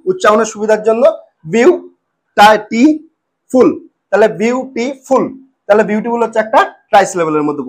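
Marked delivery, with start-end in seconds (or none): 1.5-3.7 বিউ, টি, ফুল as three pieces with long pauses; split into syllables. Shown as a demonstration. Teacher breaking beautiful into three, each syllable said separately. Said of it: "একটু একটু করে ভাঙছি". 4.4-5.2 বিউটিফুল slowly as বিউ, টি, ফুল; three separate beats in বিউটিফুল; split into syllables. Shown as a demonstration. Teacher recapping a three-syllable word, বিউটিফুল said piece by piece. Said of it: "একটু একটু করে ভাঙছি".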